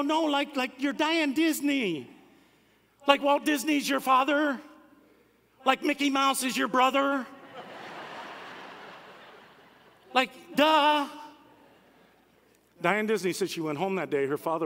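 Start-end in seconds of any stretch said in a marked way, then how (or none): 0.0-11.1 high, exasperated schoolkid voice; incredulous kids. Retold or imitated. Classmates explain her own fame to Diane, incredulous. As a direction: spell out the obvious like an astonished child. Kids set the girl straight.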